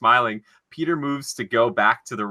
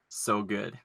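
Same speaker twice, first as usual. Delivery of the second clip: quiet quote; 2 semitones lower, 10 dB quieter than usual. Acted. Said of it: "One of my favorite lines".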